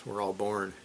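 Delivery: usual speaking voice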